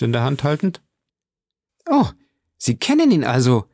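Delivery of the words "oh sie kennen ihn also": a touch of beaming delight; light, happy cry of recognition; joyful exclamation. A narrator's reading. Julie learns the count may know her benefactor, joyfully. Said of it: "rief Julie mit freudestrahlenden Augen".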